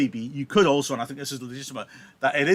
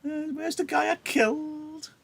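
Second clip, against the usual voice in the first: much higher, mocking brag; bragging Tybalt. Tybalt returning to brag to Romeo, high mocking voice. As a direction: swagger in, gloating. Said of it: "Tybalt comes back as if to brag".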